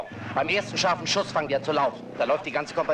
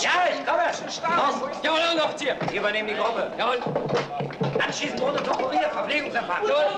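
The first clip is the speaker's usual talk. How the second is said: shouting over noise; 14 semitones higher, 3 dB louder than usual. Acted. Shouting over the chaos of departure.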